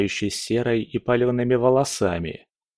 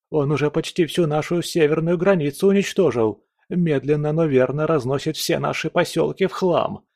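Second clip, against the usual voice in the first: panicked minion; 6 semitones higher than usual. Acted. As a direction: babble the bad news in panic.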